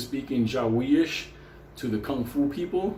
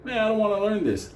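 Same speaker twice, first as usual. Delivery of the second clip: higher, scoffing and unimpressed; mock dismissive; 8 semitones higher than usual. Benny scoffing at kung fu, higher and dismissive. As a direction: brush it off as beneath him. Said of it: "he was like".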